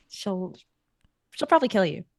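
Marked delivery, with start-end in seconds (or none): none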